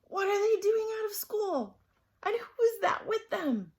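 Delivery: high, loud scratchy questions; lightly scratchy, alarmed teacher. A narrator's reading. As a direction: demand answers high, with a hint of scratchiness. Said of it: "she said in a scratchy voice".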